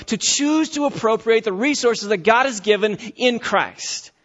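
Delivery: rising revival preacher; preaching. Acted. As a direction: preach it out with fire, pitch lifted. Preacher rising to full force.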